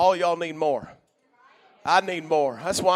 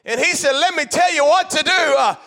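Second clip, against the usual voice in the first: preaching at full force; 4 semitones higher than usual. Real, not acted. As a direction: preach it out over the congregation.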